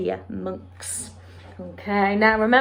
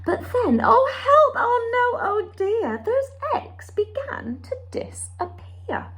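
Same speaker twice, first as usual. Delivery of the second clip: high, dramatic oh-no narration; oh help oh no, mock panic; mock alarm; 14 semitones higher than usual. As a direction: gasp it out like a worried storyteller. Narrating eggs vanishing in alarm.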